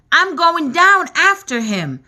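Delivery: cried out. A narrator's reading. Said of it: "cried James".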